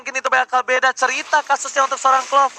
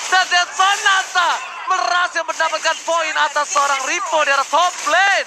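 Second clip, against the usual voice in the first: shouted kill call. Real, not acted. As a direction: yell out the kill for the crowd.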